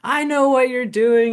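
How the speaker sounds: high, loud mock accusation; angry neighbor accusing the crow feeder, half-acted; retold angry neighbor. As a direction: throw the line back the way he snapped it. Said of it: "he was so mad".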